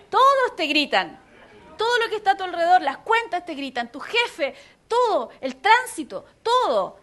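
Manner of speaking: loud, punched-out pile-up of items; emphatic loud listing. Real, not acted. Preacher listing everything that yells at you, loud and emphatic. Piling up the voices shouting at you.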